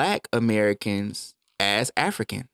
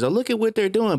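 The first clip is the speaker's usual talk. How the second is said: high, sneering mock of smug onlookers; mocking how Americans laugh at other countries; mocking gloat; 10 semitones higher than usual. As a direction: jeer at them like a smug bystander, pitched way up. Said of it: "we laugh at China and we laugh at Russia".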